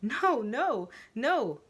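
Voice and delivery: repeated shouted no; barking no. Acted. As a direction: bark it to shut her down. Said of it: "she barks".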